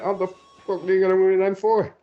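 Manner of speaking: high-pitched imitation of a baffled teammate; mock disbelief. Player mimicking teammate doubting the M four, incredulous. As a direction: retell it as a stunned, squeaked-up question. Retelling teammate's reaction a second time.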